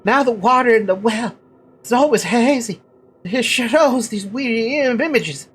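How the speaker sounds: high, halting villager stammer; pleading simpleton with a put-on stutter; stuttering character voice. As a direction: trip over first sounds in a high, eager voice. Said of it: "That's really hard to do".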